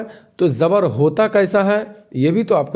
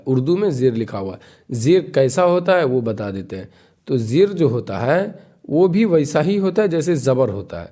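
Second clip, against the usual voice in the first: normal talking voice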